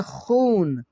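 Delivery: खून with a long, slow oo; drawn-out vowel. Shown as a demonstration. Teacher giving learners a waw maroof example, drawn out. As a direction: stretch the oo in खून. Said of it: "वाव मारूफ को हम खींच के पढ़ते हैं".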